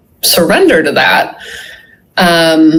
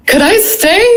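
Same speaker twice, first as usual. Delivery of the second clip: hopeful plea; 12 semitones higher than usual. Retold or imitated. Pleading to stay in vision.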